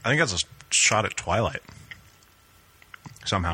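chewing while talking; talking through a mouthful of dinner; mouth full. Real, not acted. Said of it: "I gotta have you not chew into the mic".

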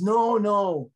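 retold agitated objection. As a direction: insist urgently, imitating an agitated son. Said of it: "he was very agitated".

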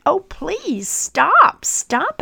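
crying plea. Acted. Clay choking on paint fumes, pleading high. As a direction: beg him to stop. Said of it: "I cried".

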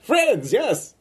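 high eager little robot; excited robot voice. Acted. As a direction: chirp it like a happy robot.

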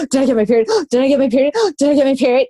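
gasping, hammed-up alarm; quick gasp before each anxious question; mock panic. Woman acting out worry that her period started, mock panic.

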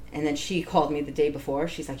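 ordinary talk, nothing marked

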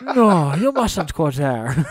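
slow Russian warning that sounds Scottish; would-be Russian with a Scottish sound; botched Russian accent. Acted. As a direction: try for a Russian innkeeper, come out Scottish. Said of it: "why my Russian sounds Scottish".

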